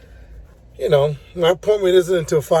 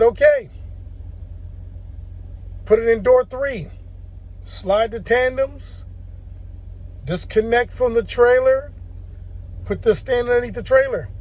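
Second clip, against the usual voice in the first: voicing the dispatcher; 8 semitones higher than usual.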